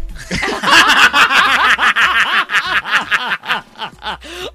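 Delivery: long loud rolling laughter. Real, not acted. Host laughs loudly.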